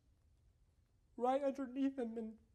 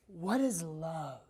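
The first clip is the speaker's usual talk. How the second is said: drawn out, slow wondering; musing on love to himself, slow and drawn out; slow musing question; 6 semitones lower, much slower than usual. Retold or imitated. Alone with his son in the backyard, slow musing. Thought quoted slowly.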